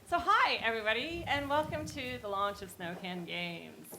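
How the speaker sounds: host welcoming a crowd; in front of an audience. Real, not acted. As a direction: greet the audience warmly.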